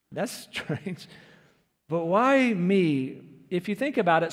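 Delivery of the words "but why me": mock self-pity. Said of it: "a weird statement".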